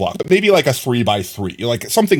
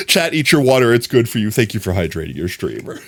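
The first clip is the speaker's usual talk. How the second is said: ordinary delivery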